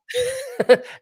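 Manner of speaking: sudden high burst of laughter; high squeal of laughter. Real, not acted. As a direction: squeal with delight. Laughing at a comment.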